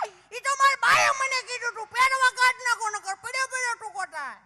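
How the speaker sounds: put-on high, loud childish voice; child's voice. Boy explaining to the teacher, high child voice. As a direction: answer like a small village boy.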